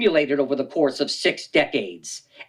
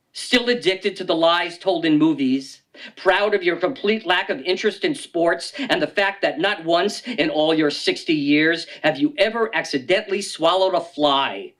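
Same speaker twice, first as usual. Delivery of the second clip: usual speaking voice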